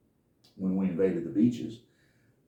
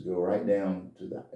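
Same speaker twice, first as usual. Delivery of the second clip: usual speaking voice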